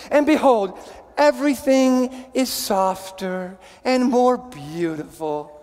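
slow, syrupy sentimental reading; sappiest voice. Pretend. Pastor reading a greeting-card phrase, slow and sappy. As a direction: drip with sugary Christmas sentiment. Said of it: "I tried to put in the most sappiest voice I could".

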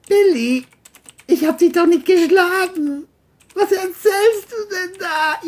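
high, loud coaxing with menace; John pressuring. Acted. John pressures Billy in front of his mother, high and loud. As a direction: lean on the kid.

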